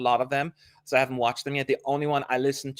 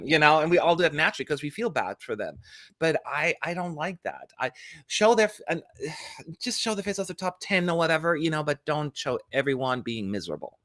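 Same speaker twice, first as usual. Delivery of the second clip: ordinary talk, nothing marked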